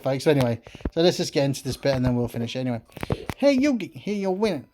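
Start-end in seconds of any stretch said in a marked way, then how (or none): 3.4-4.7 character voice. Acted. Voicing game characters' dialogue.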